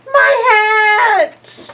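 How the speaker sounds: high, loud cry of dismay; startled Santa yelling; alarmed shout. Acted. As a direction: shout for the lost hat. Santa loses his hat.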